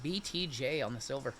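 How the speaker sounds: ordinary talk, nothing marked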